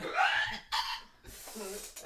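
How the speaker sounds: loud, rough retching noise; mock gagging. Game master voices the dog gagging on stench, retching. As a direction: gag noisily like a sickened dog. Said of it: "visibly gag as it".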